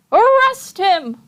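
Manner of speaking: high, loud, slow demand; crowd cry. Acted. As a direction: call out from the audience. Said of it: "took up the cry".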